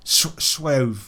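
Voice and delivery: "suave" said to rhyme with stove; mispronounced suave. Shown as a demonstration. Settling on a version, "suave" mispronounced as "swove".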